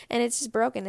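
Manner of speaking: ordinary talk, nothing marked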